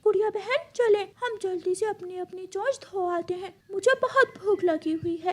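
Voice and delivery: a small chick hurrying his sister; lightly voiced child bird. Little brother to sister, eager child voice.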